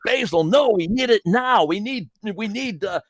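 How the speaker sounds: lightly voiced pushy plea, high; mock urgent demand at Basil. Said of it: "And it's like".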